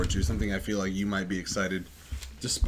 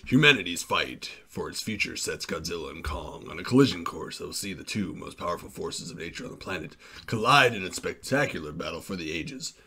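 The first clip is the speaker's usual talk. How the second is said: trailer voice; 5 semitones lower, 4 dB quieter than usual. Shown as a demonstration. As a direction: read it like an epic movie trailer. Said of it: "let me get a trailer voice".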